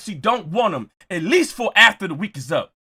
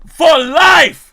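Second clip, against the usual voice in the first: higher, louder, hammered repeat; loud punchline repeat; 5 semitones higher, 6 dB louder than usual. Real, not acted. Closing the rant to the audience, shouting "For life.". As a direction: slam the punchline home.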